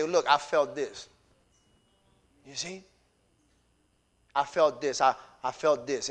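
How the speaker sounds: a hint of a tender confession; quiet confession. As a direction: confide it gently. Confiding to his wife.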